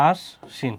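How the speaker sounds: "আশ্বিন" broken as ash-shin, sh doubled; doubled consonant. Shown as a demonstration. Teacher showing the two s sounds, "আশ্বিন" with doubled sh. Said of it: "দুইটা স।".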